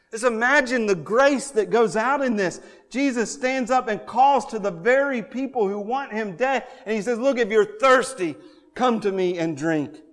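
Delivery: impassioned preacher; fervent preaching. Acted. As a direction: hammer the point home. Preaching at full force.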